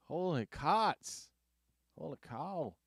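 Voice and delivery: high, drawn-out mock Res accent. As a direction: stretch it slowly in a Native reservation accent. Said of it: "they got the Res one".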